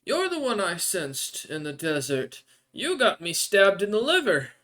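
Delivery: high, startled, then accusing creature voice; startled desert NPC. Acted. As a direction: jump up in surprise at the dragon, then accuse it.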